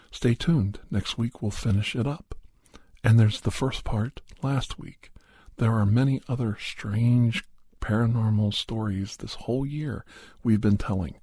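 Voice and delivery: ordinary delivery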